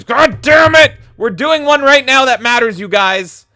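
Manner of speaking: hammed-up indignant shout; mock outrage. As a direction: yell it like the rival show is an insult. Mock outrage at rival award show.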